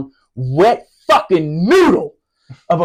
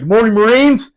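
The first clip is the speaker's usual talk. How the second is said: gung-ho greeting; 7 semitones higher, 7 dB louder than usual. Retold or imitated. Staff sergeant greets his Marines every morning, loud shout. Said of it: "He's like".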